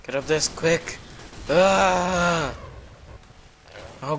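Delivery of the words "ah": sharp loud cry of pain as he dies; pained yell. Real, not acted. Gamer dying in a match, loud pained cry. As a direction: yelp out when you get hit. Killed mid-game by enemy.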